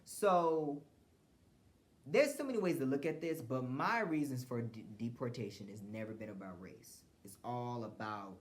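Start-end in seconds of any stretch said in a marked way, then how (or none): none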